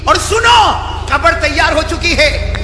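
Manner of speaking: loud, high fire-and-brimstone sermon; shouted preacher's exhortation; full-force preaching. Acted. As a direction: thunder the warning at the whole crowd.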